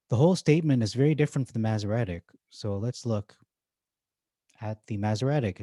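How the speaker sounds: normal talking voice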